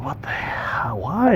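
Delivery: hushed, baffled what the hell; a stunned half-whisper; whispered disbelief. Retold or imitated. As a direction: breathe it out in disbelief. Reader's reaction, whispered.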